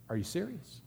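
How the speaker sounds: Jesus gently testing the eager man, softly; quiet challenge. Retold or imitated. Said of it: "but he just says".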